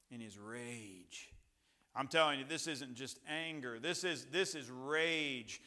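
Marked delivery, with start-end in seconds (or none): 0.1-1.3 very soft, low and slow; grave, drawn-out near-murmur; hushed repeat. Shown as a demonstration. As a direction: let the words sink in quietly. Repeats the phrase hushed for weight.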